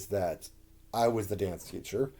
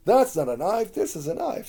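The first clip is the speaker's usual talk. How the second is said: high-pitched mock Australian accent; Crocodile Dundee; 10 semitones higher, 4 dB louder than usual. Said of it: "play Crocodile Dundee with my Australian accent".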